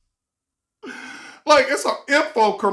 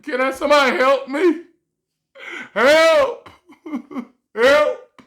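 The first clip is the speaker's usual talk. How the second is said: louder, higher pleading calls for help, laughing through it; mock cry for help; 5 semitones higher, 5 dB louder than usual. Vlogger begging viewers for help, mock desperate shout. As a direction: beg for help in a hammed-up, laughing wail. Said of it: "Like, you're begging for it".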